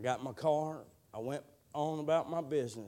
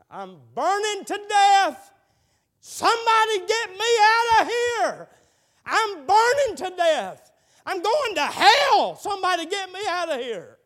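screaming in flames; 16 dB louder than usual. Retold or imitated. Preacher voicing the dying boy trapped in his truck, shrieking in agony. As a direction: scream like a man burning alive. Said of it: "They could hear him screaming".

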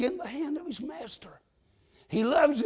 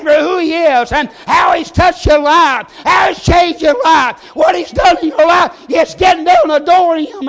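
loud, shouted sermon delivery; full-force preaching; 4 semitones higher, 17 dB louder than usual. Real, not acted. Preacher telling the church what worship is, full-force preaching.

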